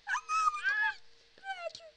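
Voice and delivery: high squeaky girlish imitation; little girl voice. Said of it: "Falou com voz de menininha agora".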